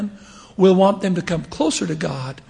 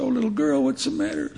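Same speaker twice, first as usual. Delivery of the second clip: higher, softer, caring voice; gentle concern; 7 semitones higher, 4 dB quieter than usual. Retold or imitated. Bishop gently asking a lost girl what's wrong. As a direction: coax a crying child kindly. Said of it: "I said".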